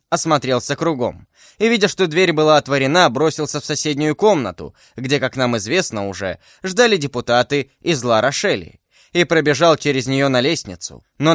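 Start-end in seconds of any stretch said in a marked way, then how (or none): none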